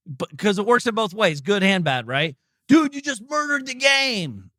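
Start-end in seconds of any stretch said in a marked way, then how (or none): 2.7-4.5 mock flatterer. Mocking flattering peers.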